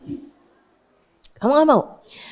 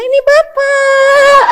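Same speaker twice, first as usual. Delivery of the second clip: put-on high drawn-out baby-greeting voice; doting father; 9 semitones higher, much slower than usual. Preacher mimicking a dad showing himself to his newborn, high cooing. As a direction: coo it slowly, pitched way up.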